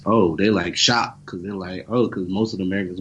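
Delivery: ordinary talk, nothing marked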